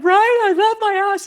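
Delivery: high, loud exultant cry; retold emotional outburst. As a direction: belt it out as a joyful realisation. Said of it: "I was crying and she was crying".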